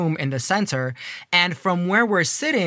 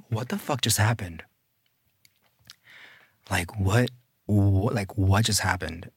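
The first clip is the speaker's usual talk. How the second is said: stunned murmur; 12 semitones lower, 6 dB quieter than usual. Retold or imitated. Retold bathroom freak-out.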